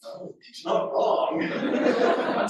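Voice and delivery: high, very slow, through laughter; dad siding with son, laughing; laughing agreement. Retold or imitated. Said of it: "And Ken's like".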